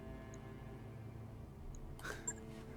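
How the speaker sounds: quiet breathy villain laugh. Acted. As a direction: chuckle darkly to yourself. Captive villain laughs after taunt.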